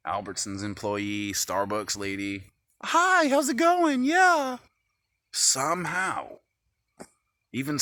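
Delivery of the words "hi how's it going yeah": very high, slow mock-cheery hello; mock sweet greeting. Mocking the Starbucks lady's high greeting. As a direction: squeak out a fake friendly hi. Imitating women greeting others.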